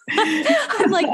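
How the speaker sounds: high bursting laugh. Real, not acted. Host cracking up at the guest's app pitch, high laughing.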